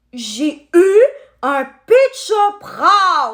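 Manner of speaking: slow and proud; slow boast. Real, not acted. Telling viewers she owned a rare one, slow proud boast. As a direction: savour every word of having a rare one.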